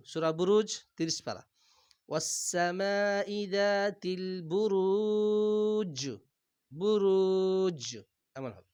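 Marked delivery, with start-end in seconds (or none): 2.1-7.8 slow, drawn-out tajweed chant; Quran recitation. Acted. Teacher recites the verse slowly for students. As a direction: stretch each vowel like a reciter.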